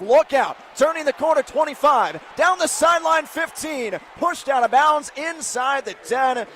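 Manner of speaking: energetic live football call; excited play-by-play. Real, not acted. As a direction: punch out each yard marker excitedly. Live big-run call.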